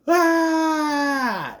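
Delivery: high, sudden scream of shock; startled scream. Retold or imitated. The boy at movie's end, screaming at nothing in fright. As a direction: yelp as if seeing something horrible. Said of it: "He just goes".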